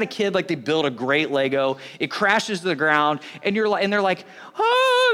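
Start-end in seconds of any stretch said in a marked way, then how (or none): 4.6-5.1 high, loud wailed "oh"; long high mock cry; mock child's wail. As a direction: imitate a kid crying out in dismay. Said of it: "they're crying, they're screaming".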